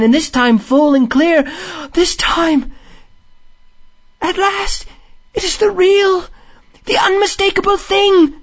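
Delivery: high, building to an ecstatic cry; rising rapture. A narrator's reading. Rat hears the song return in full, enraptured.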